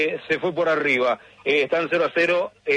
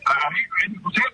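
muffled robotic voice on a bad line; underwater phone line; 5 semitones higher, faster than usual. Real, not acted. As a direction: sound like a call from underwater. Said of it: "Está como bajo el agua".

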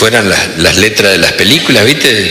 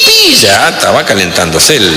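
ordinary talk, nothing marked

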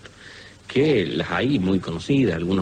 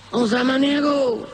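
high, slow call of Don Samaniego across the yard; calling out at the gate; slower than usual. Acted. Ailing visitor calls the healer from the gate, high and drawn out.

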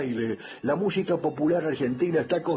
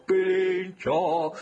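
drawn-out call; 4 semitones higher, much slower than usual. Pretend. Host hailing the carnival's bandleader, drawn-out call. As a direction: stretch out both vowels of Pirincho, higher.

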